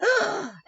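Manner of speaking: sharp high gasp; gasp for air. Acted. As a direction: gasp as if surfacing from the pool. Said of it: "and gasped for air".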